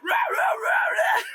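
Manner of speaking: very high, raspy mock screaming; vocal fry scream. Shown as a demonstration. Telling the singer to add fry, mock metal scream. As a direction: bark out fried metal screams. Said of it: "put some extra fry to it".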